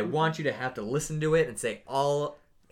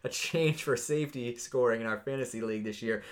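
usual speaking voice

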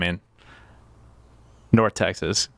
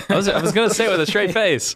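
laughing; 10 semitones higher than usual. Real, not acted. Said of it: "you already started laughing".

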